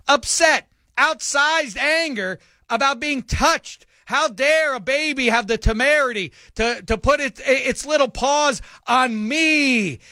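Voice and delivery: high, mocking voice of the angry woman; high mock-outraged voice; mocking outrage. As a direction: ham up her fury at being touched.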